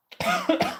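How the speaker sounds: sudden dry cough from dust. Real, not acted. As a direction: cough as dust catches your throat. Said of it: "Oh, I just inhaled dust".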